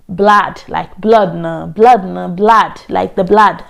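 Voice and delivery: "blood" said as "blad", short open vowel; Ghanaian "blood". Retold or imitated. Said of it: "she was like".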